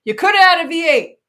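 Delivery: hammed-up V8 ad voice; mock TV ad voice. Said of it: "I said".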